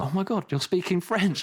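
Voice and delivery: high-pitched mimicked astonishment; imitated surprise, pitched well up; surprised realisation. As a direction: act out someone's sudden amazed discovery. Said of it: "suddenly they realise".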